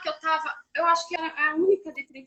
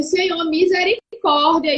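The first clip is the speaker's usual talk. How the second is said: pleading prayer; 2 semitones higher, 7 dB louder, slower than usual. Retold or imitated. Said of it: "eu falei".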